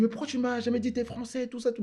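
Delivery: high, squeaky mock voice of the friend; mimicking his friend. Retelling how his Skype buddy reacted, in a high mock voice. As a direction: pitch way up to play the laughing French streamer. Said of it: "Il me dit".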